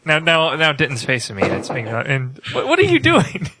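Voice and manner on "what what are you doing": high, laughing voice; laughing protest. Real, not acted. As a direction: protest through real laughter. Cracking up at co-host's distracting antics.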